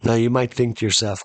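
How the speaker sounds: normal talking voice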